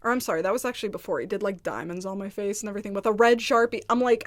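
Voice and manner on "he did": ordinary talk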